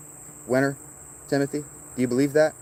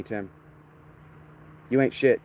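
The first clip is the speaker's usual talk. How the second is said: usual speaking voice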